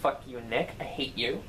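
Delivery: ordinary talk, nothing marked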